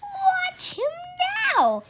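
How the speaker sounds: very high, loud cry; cried out. A narrator's reading. Father crying out to family to watch, loud. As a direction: shout it out in excitement. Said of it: "cried Mister Cranky".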